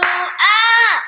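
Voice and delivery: high loud excited squeal. Real, not acted. Caught up in a song on her live stream, squealing with glee. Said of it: "I got a little excited".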